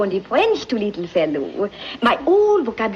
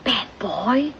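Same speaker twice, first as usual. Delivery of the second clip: put-on hushed whispered aside in a French accent; French-accented whispered question; 4 dB quieter than usual. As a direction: lean in and whisper it conspiratorially. Maid asks about the boy.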